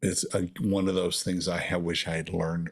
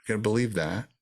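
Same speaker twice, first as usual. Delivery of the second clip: usual speaking voice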